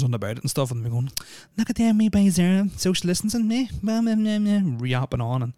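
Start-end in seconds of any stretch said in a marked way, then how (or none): 1.6-4.6 high, mocking gossip voice, much higher; mocking Karen. Mimicking the Karen walkers gossiping about young ones, high mock voice. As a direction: sneer it like a nosy busybody.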